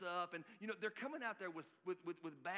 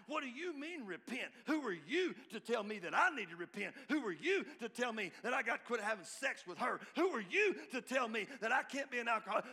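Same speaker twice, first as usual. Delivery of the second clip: loud, high, indignant heckler voice; mock outraged critic; 6 semitones higher, 8 dB louder than usual. Preacher voicing angry critics, loud indignant shout. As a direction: shout down the preacher like an offended hater.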